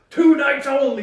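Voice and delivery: loud, high arena announcer boom; monster truck ad. Pretend. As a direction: shout it like a hyped radio ad. Joining the monster truck announcer intro.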